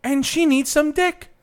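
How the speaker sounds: mock scream, high and loud; hammed-up shout; screaming old man. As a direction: holler it in imitation. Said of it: "He's screaming".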